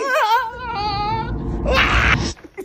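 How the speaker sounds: long, high, loud shriek of laughter; high screaming laugh. Real, not acted. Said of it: "Sumigaw siya!".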